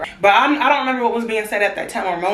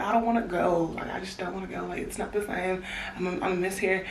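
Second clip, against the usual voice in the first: soft, near-murmured pleading; softly retold plea not to go; quiet plea; 2 semitones lower, 11 dB quieter than usual. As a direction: go small and soft, begging not to leave.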